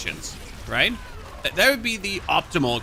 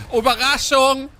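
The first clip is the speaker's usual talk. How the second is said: loud, high over-the-top German greeting; fake German; 11 semitones higher, 7 dB louder than usual. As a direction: shout it like a surprise reveal. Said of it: "To speak the fake German".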